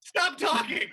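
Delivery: high, loud command to shut up; exasperated shout. Real, not acted. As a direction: shout over everyone to cut them off. Cutting off the other players.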